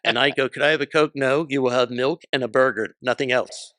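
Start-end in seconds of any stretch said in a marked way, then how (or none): 0.5-1.1 higher, louder retold kid asking; child's request. Young [name] asks mom for a Coke, child's request. 1.2-3.7 louder retold mother laying down the law; lightly voiced strict mom; firm refusal. Mom refuses her son a Coke, firm refusal.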